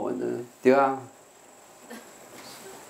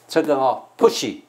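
slow, weighted 这 before push; teacher showing where the stress falls; pointing out the stress. Shown as a demonstration. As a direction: stress this part, say it slowly. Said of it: "重音在这里".

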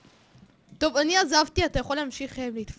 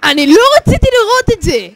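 high, loud wail of horror; shrieked protest, all at full volume; horrified shout; 11 semitones higher, 14 dB louder than usual. Real, not acted. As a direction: cry out, appalled by the sight. Horrified by what he saw.

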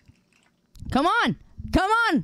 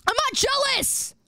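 high defensive denial; 12 semitones higher than usual. Real, not acted.

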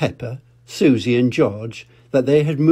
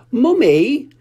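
put-on high loud child's call for mummy; 13 semitones higher, 5 dB louder than usual. Peppa calling her mother from the doorway, high and loud. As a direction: cry out for mummy. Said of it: "cried Peppa".

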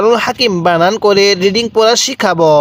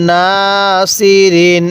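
নাসিরিন very slow, first vowel held level; one-alif elongation; much slower than usual. Shown as a demonstration. Teacher showing how to read, drawn-out নাসিরিন. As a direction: chant নাসিরিন slowly with a long first syllable. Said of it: "এক আলিফ টানতে হবে".

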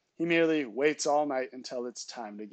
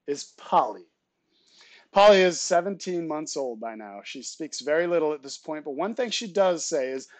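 usual speaking voice

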